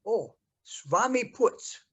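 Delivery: put-on high, loud, slow Hungarian-American accent; Veronica's Hungarian-American accent. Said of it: "Hungarian American accent, she says".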